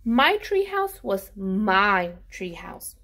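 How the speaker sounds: ordinary delivery, nothing marked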